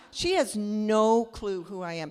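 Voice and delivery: usual speaking voice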